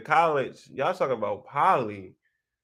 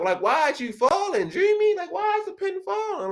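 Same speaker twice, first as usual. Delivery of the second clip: mock disbelief. Teasing a commenter about her post.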